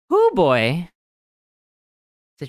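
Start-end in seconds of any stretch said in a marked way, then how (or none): 0.1-0.9 loud joyful exclamation; relieved cheer. Real, not acted. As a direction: let out relief and joy. After correct guess.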